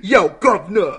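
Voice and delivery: a hammed-up London street greeting with a laugh; mock British accent. Podcast host imitating [name] with a British accent, laughing. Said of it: "[name] speaking with a British accent".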